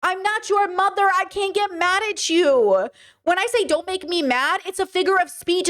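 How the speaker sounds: insist, fired up but not angry; passionate. Real, not acted. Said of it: "me getting loud and passionate".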